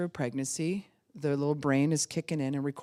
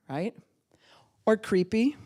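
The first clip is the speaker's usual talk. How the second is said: ordinary delivery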